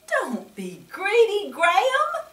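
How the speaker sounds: put-on teacher calling out to the running boy. A narrator's reading. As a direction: call it out across the playground. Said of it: "the teacher called out".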